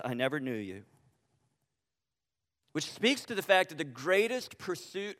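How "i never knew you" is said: low, hushed, grave; quiet solemn verdict. Acted. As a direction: drop it down, simple and final. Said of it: "Jesus simply says".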